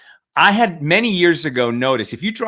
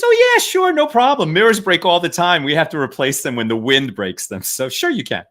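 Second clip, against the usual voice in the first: breezy, much higher happy agreement; cheerful yes; 4 semitones higher than usual. Retold or imitated. CEO happily allowing the mirrors to be broken, high cheerful. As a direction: toss off a bright, carefree yes. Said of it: "And he says".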